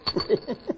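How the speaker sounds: breathy gloating chuckle; gloating laugh. Acted. Turkey gloats at Kendall across the table, smug chuckle.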